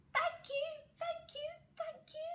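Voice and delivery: very high squeaky mice; high squeaking baby mice; squeaky thanks. Acted. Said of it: "they squeaked".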